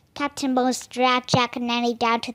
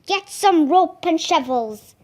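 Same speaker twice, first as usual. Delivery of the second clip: roar; 4 semitones higher, 5 dB louder, slower than usual. A narrator's reading. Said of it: "he roared his men".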